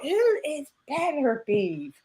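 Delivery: softly, slowly puzzled; drawn-out bafflement, voice up at the start and soft. Retold or imitated. Co-host recalling confusion over a boxer's name, slow and puzzled. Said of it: "I was like".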